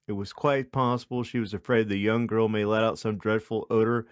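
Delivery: ordinary delivery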